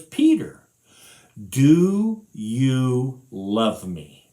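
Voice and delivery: very slow, heavy question; slow earnest question. Acted. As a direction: draw out each word. Jesus asks, slowed, earnest.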